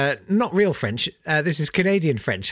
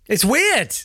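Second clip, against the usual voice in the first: usual speaking voice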